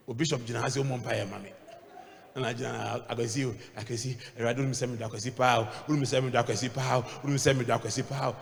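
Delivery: quick, low mock prayer murmur; lightly hammed-up rushed prayer; fast mock prayer. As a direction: rattle off the prayer. Acted fast mumbled prayer.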